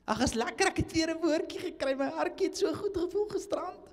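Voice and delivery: gushing churchgoer. Pretend. Preacher mocks a gushing churchgoer after conference, high sweet voice. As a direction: ham up the sweet fan.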